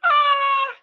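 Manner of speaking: high loud squeal; high delighted squeal; excited squeal. Real, not acted. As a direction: let out a delighted shriek. Laughing at mom's impression.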